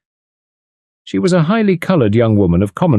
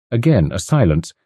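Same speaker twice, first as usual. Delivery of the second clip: usual speaking voice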